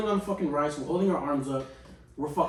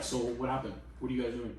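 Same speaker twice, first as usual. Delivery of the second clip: calm dad voice; 6 semitones lower, 10 dB quieter than usual. Retold or imitated. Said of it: "not even fucking yelling".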